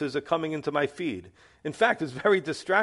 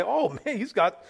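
mock-impressed, higher and fast; impressed viewer; 4 semitones higher than usual. Preacher mocking a viewer wowed by dancers, high and quick. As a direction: play the easily entertained channel surfer. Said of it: "Then they say".

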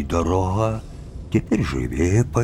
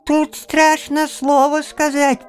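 very high, loud squeak; squeaking beak; 4 dB louder than usual. Acted. Bird's beak echoing grandfather, high squeak. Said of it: "пискнул птичий нос".

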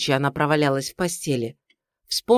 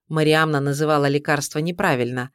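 ordinary delivery, nothing marked

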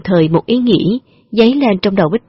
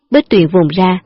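usual speaking voice

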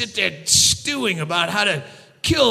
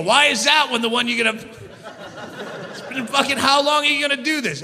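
usual speaking voice

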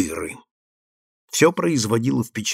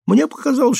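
usual speaking voice

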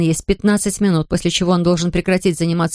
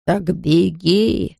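put-on stern old man; much slower than usual. A narrator's reading. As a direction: order her firmly. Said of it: "строго велел он".

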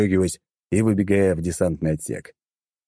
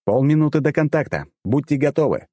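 raised, higher-pitched alert; commander on comms; 7 semitones higher than usual. A narrator's reading. Commander warns squad over internal comms, raised urgent call. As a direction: pass the warning on to the squad. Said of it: "продублировал по внутренней связи".

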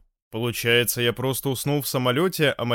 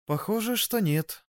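shy, softer admission; embarrassed; 8 semitones higher, 3 dB quieter than usual. A narrator's reading. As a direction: say it a little embarrassed. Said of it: "смущённо признала девушка".

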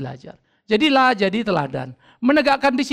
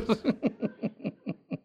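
soft lingering chuckle; quiet laugh; 9 dB quieter than usual. Real, not acted. Preacher still amused at himself, quiet chuckle. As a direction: let a small laugh trail off.

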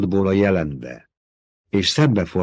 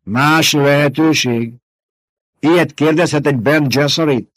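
higher, louder incredulous scoff; scornful old woman; 7 semitones higher, 6 dB louder than usual. Acted. Old woman scoffing at Jessica's question. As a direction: throw the question back with scorn.

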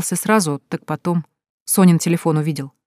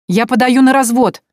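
higher, louder cry to his back; flung out; 8 semitones higher, 6 dB louder than usual. A narrator's reading. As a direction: hurl it after him. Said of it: "бросила Соня ему в спину". (бросает мужу в спину, громко)

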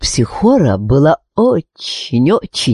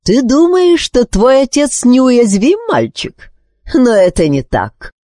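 high, scornful put-down; angry, disdainful queen; contemptuous anger; 12 semitones higher than usual. A narrator's reading. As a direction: cut the boy down with contempt. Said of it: "презрительно перебила его Психора, дрожа от гнева".

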